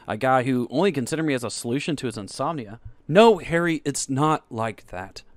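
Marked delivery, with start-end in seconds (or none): 3.1-5.2 loud then slowing protest; urgent protest. Acted. Max protesting to Harry, urgent then slow. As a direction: cut him off, then plead slowly. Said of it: "Max protested".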